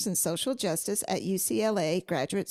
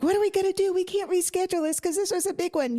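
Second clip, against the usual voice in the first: retold panic; 10 semitones higher, faster than usual. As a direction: sound frantic about losing the interview. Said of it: "I was like".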